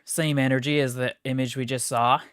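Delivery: normal talking voice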